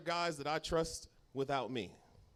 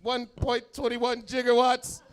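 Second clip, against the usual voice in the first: movie quote; 8 semitones higher, 7 dB louder, slower than usual. Retold or imitated. Back to the Future quote.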